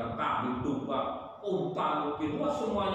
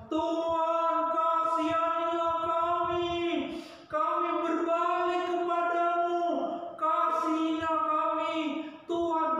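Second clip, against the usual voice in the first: mournful plea; 12 semitones higher than usual. Acted. The people of Nineveh crying to God, high half-sung plea. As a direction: wail it up to heaven, begging. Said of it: "Mereka berseru pada Tuhan".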